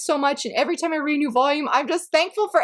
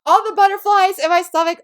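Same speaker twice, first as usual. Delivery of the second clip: louder and higher, bursting with glee; excited gushing; 5 semitones higher, 6 dB louder than usual. Real, not acted. Raving to viewers about the fluffy romance, giddy excitement. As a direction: let the butterflies spill out in the voice. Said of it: "I'm getting so excited, I can't even talk".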